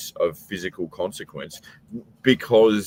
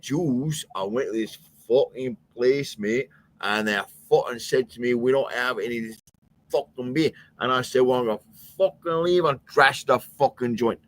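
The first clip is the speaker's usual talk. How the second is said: Glasgow brogue, a little more measured; Glaswegian accent, slowed down; 4 semitones higher than usual. Retold or imitated. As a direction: retell it in thick but more careful Glaswegian. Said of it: "Like, just slow down".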